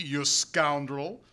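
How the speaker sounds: plain reading voice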